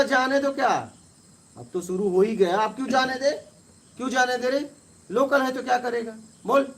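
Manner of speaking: loud, high, heated demand; heated confrontation. Retold or imitated. To the man saying let it go: loud, heated demand.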